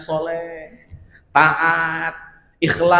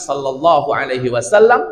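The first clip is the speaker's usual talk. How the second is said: normal talking voice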